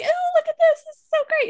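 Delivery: high, bubbly show-and-tell; gushing. Retold or imitated. Showing Ty her writing, gushing with excitement. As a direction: squeal it with delight. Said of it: "Sometimes I'll be like".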